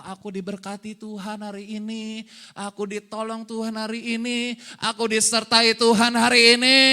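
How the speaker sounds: level chant-like recitation building to a loud finish; shopkeeper's declaration. Acted. Preacher acting someone opening a shop, building chanted declaration. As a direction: proclaim it with stretched endings, swelling to a shout. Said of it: "Mau buka toko".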